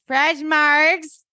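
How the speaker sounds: high, singing-out announcement; high hammed-up hostess call; mock-cheery deflection. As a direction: call it out brightly to dodge talking. Mother dodges with margarita offer.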